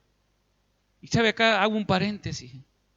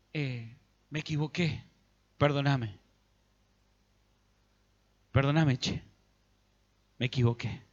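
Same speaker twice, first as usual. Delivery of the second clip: Saul's fake apology; 6 semitones lower than usual. Saul to Samuel, insincerely contrite and lower. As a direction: play a hollow, hangdog apology.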